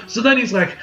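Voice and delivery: normal talking voice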